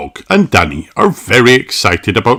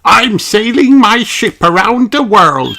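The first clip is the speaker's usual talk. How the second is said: high, loud playful pup voice; a little dog playing sea captain; barking puppy Danny; 13 semitones higher, 6 dB louder than usual. A narrator's reading. Danny playing pirates with friends, loud high pup voice. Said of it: "barks Danny".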